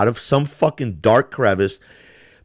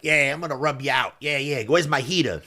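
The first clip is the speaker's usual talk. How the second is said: put-on Brooklyn gangster accent; 2 semitones higher, 8 dB quieter than usual. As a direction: mutter it like an old Brooklyn mobster. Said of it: "old, Brooklyn gangster thing".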